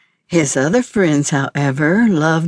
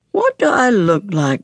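huffed; 4 semitones higher than usual. A narrator's reading. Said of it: "Rex huffed".

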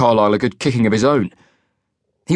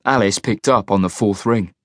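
ordinary delivery, nothing marked

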